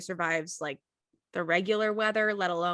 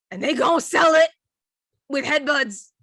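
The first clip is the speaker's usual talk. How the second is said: loud, high, punchy announcement; hammed-up fight hype, hit hard; mock showdown; 5 semitones higher, 9 dB louder than usual. Teller describes goats settling a score, loud mock drama.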